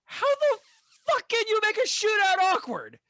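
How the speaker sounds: high, loud incredulous yell; exasperated yell. Acted. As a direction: shout it in disbelief. Acting out a movie shootout line.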